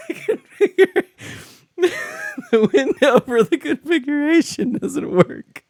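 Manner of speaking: high-pitched giggly laughter through the words; laughing helplessly. Real, not acted. Streamer giggling at a broken config window, high-pitched laughing.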